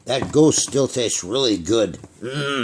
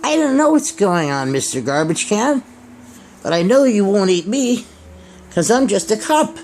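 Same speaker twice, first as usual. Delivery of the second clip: higher, bright little cup puppet voice; Mister Cup puppet; 9 semitones higher than usual. Acted. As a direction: play a cheerful talking cup puppet. Mister Cup teases garbage can.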